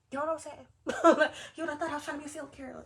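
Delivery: fast, laughing through the line; laughing disbelief, rushed out. Real, not acted. Laughing at the comparison.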